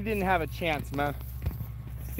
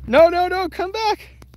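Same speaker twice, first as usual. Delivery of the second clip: alarmed urging; 8 semitones higher, 6 dB louder than usual. Real, not acted. Mole escaping.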